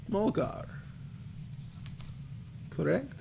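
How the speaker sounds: high, soft fortune-teller voice; crackly old crone. Acted. Fortune teller reads a name from crystal ball, soft crone. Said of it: "her voice crackles".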